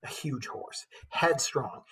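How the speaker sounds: put-on measured storytelling read of an old epic; steady, rhythmic verse narration; poem reading. A narrator's reading. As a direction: read it like a bard to the class. Teacher reads epic poem aloud.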